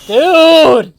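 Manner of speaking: high, loud shout of dude; shocked yell. Real, not acted. Jumping at a scare in the game, yelling in shock. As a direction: yelp it in sudden fright.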